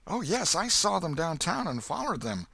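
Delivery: put-on a breathless ragged country boy; Huck the boy. A narrator's reading.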